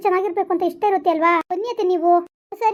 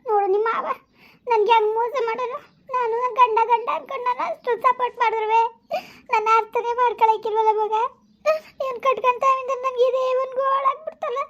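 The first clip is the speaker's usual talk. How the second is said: tearful lament, pitched higher; crying; 5 semitones higher than usual. Acted. Mother-in-law crying to her daughter-in-law about her husband. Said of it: "ಅತ್ತೆ please ನೀವು ಅಳ್ಬೇಡಿ".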